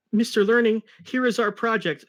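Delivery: put-on proud kid presenting homework; high, bright call to teacher; eager student. Tails to teacher in fanfic.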